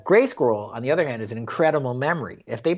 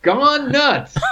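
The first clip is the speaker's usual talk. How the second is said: Gone Nuts sung out much higher like a logo; playful announcement; 4 dB louder than usual. Retold or imitated. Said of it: "in some fun font".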